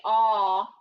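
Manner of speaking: "ah" sounded out loud and low; teacher sounding out ah loudly. Shown as a demonstration. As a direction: belt out the vowel "ah" for the class. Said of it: "He can say".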